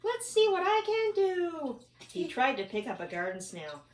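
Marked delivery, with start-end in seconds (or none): 0.0-1.8 high bug voice. Acted. Bug character voice.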